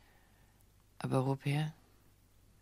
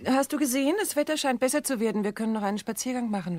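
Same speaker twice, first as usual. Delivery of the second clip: forced brightness; 6 semitones higher, 4 dB louder than usual. Acted. Woman abruptly changes the subject.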